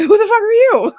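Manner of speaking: high, loud, incredulous outburst; baffled outburst. Retold or imitated. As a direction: blurt it out in pure confusion. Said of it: "I'm like".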